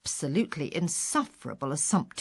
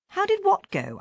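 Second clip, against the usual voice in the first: feigned confusion; 13 semitones higher than usual. Retold or imitated. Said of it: "I said".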